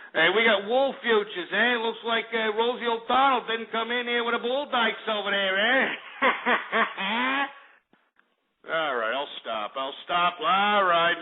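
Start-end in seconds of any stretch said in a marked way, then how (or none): 0.1-5.9 hammed-up Jersey accent, climbing higher toward a laugh; Jersey Shore accent. Host joking through wool futures, mock Jersey Shore accent. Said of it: "no more Jersey Shore crap". 5.9-7.5 high laugh at his own joke. Real, not acted. Host breaking up at his own Jersey routine, laughing. Cracks up after own bit.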